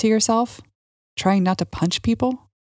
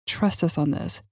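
ordinary delivery

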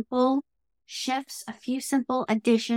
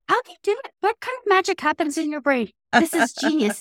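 excited; 5 semitones higher, faster than usual. Real, not acted. Client thrilled by coach's idea.

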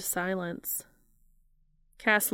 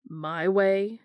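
louder, sharp retort; biting back; 4 dB louder than usual. A narrator's reading. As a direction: snap it back at him. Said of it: "Dean bit back".

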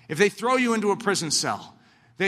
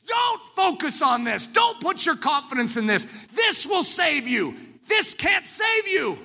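preaching at full force; 8 semitones higher than usual. Acted. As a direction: hammer it home to the crowd.

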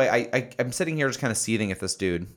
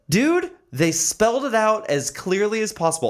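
exasperated retort; 11 semitones higher, 4 dB louder than usual. Retold or imitated. Exasperated retort to the clueless man.

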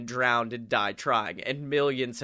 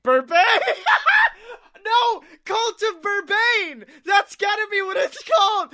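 laughing, much higher and louder yelling; excited shout; 7 dB louder than usual. Real, not acted. Player shouts the new cult name, laughing and thrilled.

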